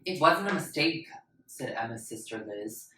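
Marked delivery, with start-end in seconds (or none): 0.0-1.1 firm contradiction. Acted. Older sister contradicts mother.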